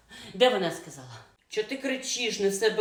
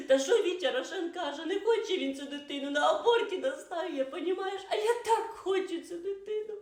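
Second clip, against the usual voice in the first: laughing tearful complaint; 9 semitones higher than usual. Acted. Pregnant wife complains to friend.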